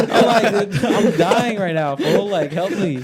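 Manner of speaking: high, loud retold plea through real laughter; laughing plea. Real, not acted. Retelling his text to a mocking homie, laughing hard. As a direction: crack up while mimicking your own desperate plea.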